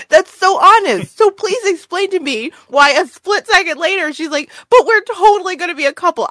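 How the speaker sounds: very high, loud, mock pleading; squeaky-high, worked-up viewer rant; exasperated outcry. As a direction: demand an explanation in disbelief. Exasperated reaction to the plot.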